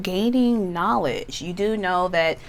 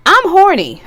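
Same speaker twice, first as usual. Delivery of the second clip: cheeky blurt; 10 semitones higher, 8 dB louder than usual. Pretend. Blurting a client's thought.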